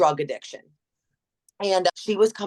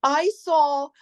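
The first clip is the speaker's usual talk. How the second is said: imitating a teary member; 9 semitones higher than usual. Member telling the trainer she was moved, a light teary imitation. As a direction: lightly act out her choking up.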